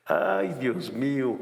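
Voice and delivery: normal talking voice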